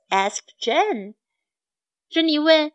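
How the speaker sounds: usual speaking voice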